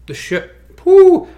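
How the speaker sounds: squeaky flustered whoo; high whoo. Real, not acted. Flustered squeal while stuck.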